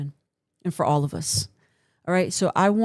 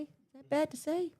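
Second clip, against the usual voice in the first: much higher, softer squeak of "Is that bad to say?"; squeaky mock innocence; 10 semitones higher, 10 dB quieter than usual. Teasing the audience after a dark joke, squeaky mock innocence. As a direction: play innocent in a tiny voice.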